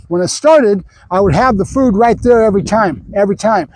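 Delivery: ordinary talk, nothing marked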